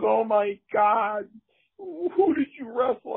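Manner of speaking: high, slow mock-alarmed mom voice; worried mother. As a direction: play mom gasping at the bruises. Mother shocked at the marks.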